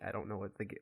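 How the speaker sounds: ordinary delivery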